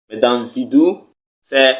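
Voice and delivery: normal talking voice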